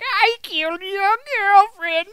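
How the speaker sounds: high, loud gloating villain line; hammed-up Gray Fox. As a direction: gloat it over the top. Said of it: "I'm like".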